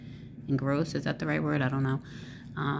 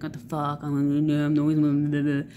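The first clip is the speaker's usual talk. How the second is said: mock cursing; 3 dB louder than usual. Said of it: "cursing while they're walking around".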